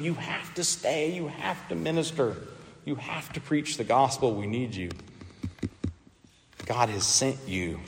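hushed, earnest mock plea; soft-spoken, insistent appeal in a quote; retold urging. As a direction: urge softly, phrase by phrase, as if retelling. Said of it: "The urging".

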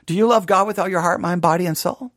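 usual speaking voice

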